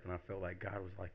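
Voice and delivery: ordinary talk, nothing marked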